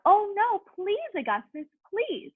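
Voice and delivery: high, desperate pleading; frantic high plea; pleading cry. A narrator's reading. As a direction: beg him to stop, voice up high. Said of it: "he cried out".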